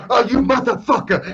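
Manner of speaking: high, loud outraged yell; hammed-up furious shout; imitating Sheik. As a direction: explode on discovering the trick, mimicking. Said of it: "and he goes".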